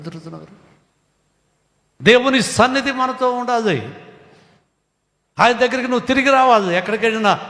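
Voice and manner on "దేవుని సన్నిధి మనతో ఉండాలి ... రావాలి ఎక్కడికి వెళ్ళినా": fervent exhortation. Real, not acted. Preacher exhorting the congregation.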